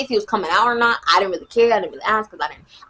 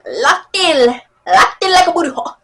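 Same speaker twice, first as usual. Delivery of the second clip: loud, higher hammed-up quote; TikTok sound imitation; 6 semitones higher, 5 dB louder than usual. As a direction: belt it like a viral soundbite.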